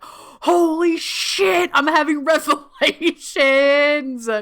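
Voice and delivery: high, slow, laughing "holy shit"; laughing amazement. Retold or imitated. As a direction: relive the shock of revelation through laughter. Retelling the first retreat day.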